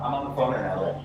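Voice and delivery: normal talking voice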